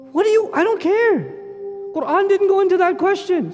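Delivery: high exasperated outburst. Real, not acted. Preacher rejecting trivial questions, exasperated. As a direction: snap back in real frustration. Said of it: "exasperated outburst".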